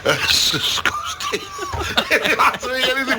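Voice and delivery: squeaking high through laughter, very slow; real high-pitched giggling over the words; laughing. Real, not acted. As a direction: crack up at your own pun. Laughing at own pun.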